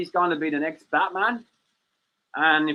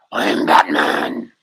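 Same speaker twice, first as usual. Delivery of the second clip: Batman growl, pitched way down and slow; gravelly Batman impression; 7 dB louder, much slower than usual. Claiming the Batman voice, deep and slow. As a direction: drop low and drag it like Batman. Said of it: "You hear the voice and all".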